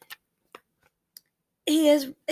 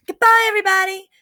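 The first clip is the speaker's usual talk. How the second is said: higher, quick called goodbye; calling out; 6 semitones higher, 4 dB louder, faster than usual. A narrator's reading. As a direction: call a cheery farewell to a group. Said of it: "I called".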